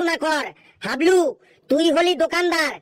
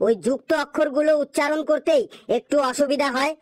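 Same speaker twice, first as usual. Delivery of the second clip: normal talking voice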